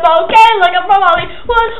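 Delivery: breathless after singing; out of breath, gasping between words; running out of air. Real, not acted. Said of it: "I'm running out of breath now".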